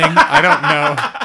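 very high, slow, laughing words. Real, not acted. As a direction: speak through a burst of laughter. Laughing through the line.

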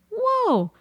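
high-pitched retold "whoa"; mock astonishment. Homeowner reacting to painter's price, mock astonished. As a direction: let out a high, taken-aback exclamation.

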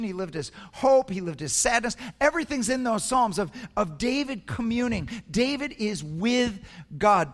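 high, loud preacher's cry building up; full-throated sermon build to a climax; fervent preaching. Acted. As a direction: preach it out to the whole room.

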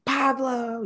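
a happy, high call of a name across the room; imitating a big greeting. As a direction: call his name like a big friendly guy walking in. Client greeting him on arrival.

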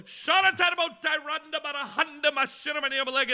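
high, wailing ecstatic chant; mock speaking in tongues. Girlfriend faking tongues on stage, high mock chant. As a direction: run the syllables together in a high wail. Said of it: "speak in the tongues".

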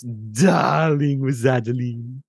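drawn-out, theatrical darling; very slow, stretched-out character greeting; Adeline character voice. Acted. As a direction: savour it slowly as a put-on character. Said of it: "and then, of course".

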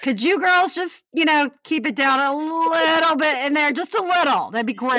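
high, overly polite request; high polite neighbor. Pretend. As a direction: ask nicely to keep it down. Neighbor asking to quiet down.

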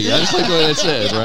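fast, high and loud through laughter; amused laughing aside. Real, not acted. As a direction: still laughing at the bit as he talks. Laughing after mock teaching.

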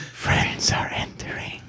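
creepy breathy whisper. Acted. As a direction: whisper it with a laugh. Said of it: "Why are you whispering?".

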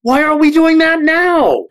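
high, exasperated why-not cry; incredulous outburst. Real, not acted. Panelist protests to colleague in high disbelief.

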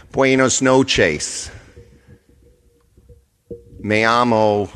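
put-on loud, drawn-out Spanish in a broken accent. Outsourced phone agent greeting a Spanish caller, broken-accent Spanish. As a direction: mangle the Spanish slowly with a heavy foreign accent. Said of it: "they speak with complete broken accent".

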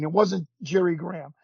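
usual speaking voice

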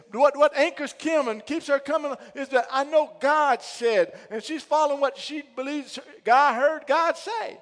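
high, loud, driving sermon; preacher rallying the church; preaching at full force. Real, not acted. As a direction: preach it with fire.

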